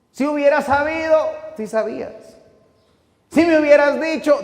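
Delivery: loud, high, ringing preacher's voice; full-force preaching. Acted. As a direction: preach it out with fire. (predicación a todo pulmón, fuerte y aguda)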